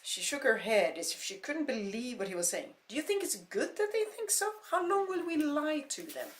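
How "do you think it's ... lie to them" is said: high, loud indignant outburst; indignant shout. Acted. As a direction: shout it in disbelief. Heated argument, rising.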